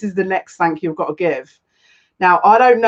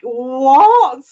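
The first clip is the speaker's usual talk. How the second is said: high, loud squawk of disbelief; stretched, higher, louder cry of astonishment; voice going weird; 6 semitones higher, 6 dB louder than usual. Retold or imitated. Streamer retelling her shock at big channels recommending her, voice going weird. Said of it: "my voice kind of went weird there".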